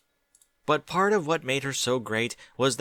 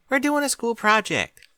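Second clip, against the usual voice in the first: put-on much higher, louder young filly voice; Apple Bloom voice; 13 semitones higher, 6 dB louder than usual. A narrator's reading. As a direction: pitch it up as an eager young filly.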